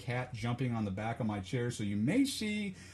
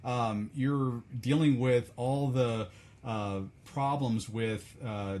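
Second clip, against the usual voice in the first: ordinary talk, nothing marked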